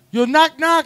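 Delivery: high, loud call at a door; mock shout to whoever is inside; acted calling out. Retells friend at the door.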